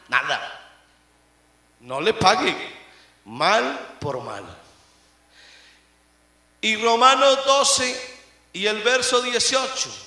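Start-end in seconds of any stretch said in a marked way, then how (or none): none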